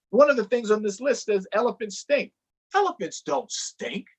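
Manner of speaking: high, huffy elephant denial; indignant elephant. Acted. Elephant denying he stinks, high and huffy. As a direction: protest with wounded pride.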